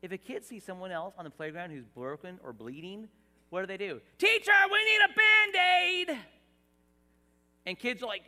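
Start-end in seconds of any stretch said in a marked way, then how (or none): 4.2-6.2 put-on much higher, louder urgent child's cry; kid's shout. A kid on the playground shouting to the teacher, high and loud. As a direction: call out like a kid who needs help.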